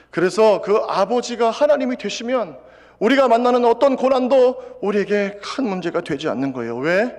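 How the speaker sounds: loud, high-pitched preaching climax; fervent preaching. Acted. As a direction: preach it with full conviction. Preaching at full force. (힘찬 설교조로 높고 크게)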